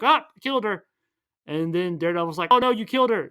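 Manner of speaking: high, loud hammed-up exclaiming; movie climax retold with high, loud exclamations; hammed-up shouts. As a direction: ham up the dramatic lines.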